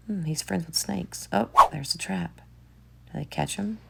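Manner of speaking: quiet, hushed aside; hushed voice tracking the chase; hushed commentary. Real, not acted. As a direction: murmur as if not to disturb. Quiet commentary over the video.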